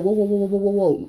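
high-pitched mock alarm; retold startled whoa, pitched high. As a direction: back off startled, in play. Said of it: "And it's like".

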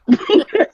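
loud burst of laughter; hearty laugh. Real, not acted. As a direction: break into a big laugh. Laughing at the bunny hop.

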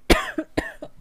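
harsh real coughing fit; dusty cough. Real, not acted. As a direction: cough hard on inhaled dust. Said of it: "Good Lord, [name]".

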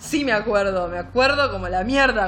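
gritando con rabia, más fuerte; furioso, casi a los gritos; enojado. Retold or imitated. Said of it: "Nunca te vi tan enojado". (gritando enojado)

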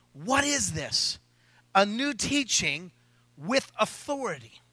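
slow, hushed, wondering; slow scripture reading. Acted. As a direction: read it out in awe, slowly. Scripture quote read slowly.

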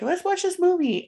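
high, eager friend voice. Retold or imitated. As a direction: pitch it up like an excited kid. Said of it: "I just remember you saying".

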